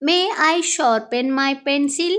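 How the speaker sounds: very slow, clear classroom English; slow model phrase. Shown as a demonstration. Teacher modelling the phrase for Telugu students, slow and clear. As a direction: spell it out slowly for learners. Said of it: "అప్పుడు ఇలా అడుగుతా".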